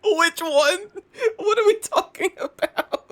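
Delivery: high, loud confused voice; hammed-up bewildered questioning; mock confusion. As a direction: play baffled and a bit panicked. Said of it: "I'm like".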